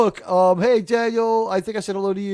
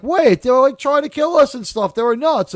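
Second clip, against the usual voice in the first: agitated reply; 9 semitones higher, 6 dB louder than usual. Retold or imitated. Retold officer arguing back.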